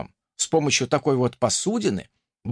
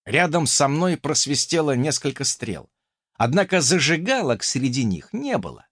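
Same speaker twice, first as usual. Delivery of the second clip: ordinary delivery, nothing marked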